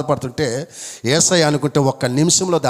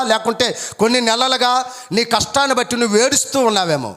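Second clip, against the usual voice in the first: high, ringing preacher's voice at full force; fervent preaching; 8 semitones higher than usual. Acted. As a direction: preach it out, lifted and urgent. (పూర్తి ఉత్సాహంతో ప్రసంగం)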